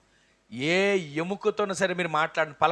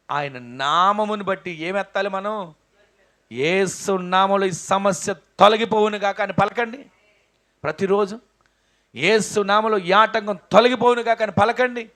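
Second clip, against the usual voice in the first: loud, slow, higher proclamation; full force preaching; 4 semitones higher, 8 dB louder than usual. Acted. Preacher leading the congregation in loud declaration. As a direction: declare it over the crowd.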